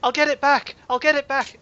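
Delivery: husband begging his wife to stay, high and loud; desperate pleading. Acted. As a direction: beg her not to leave. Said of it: "And he goes".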